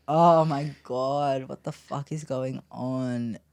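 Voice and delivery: loud, slow, stretched "Oh my God"; reliving the shock of the moment, drawn out; retold shocked cry, slow and loud; shocked outburst. Finding the teen with a pipe.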